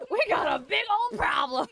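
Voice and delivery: high, loud, shouted through laughter; laughing panic shout. Real, not acted. Streamer cracking up as her character gets punched, laughing yell.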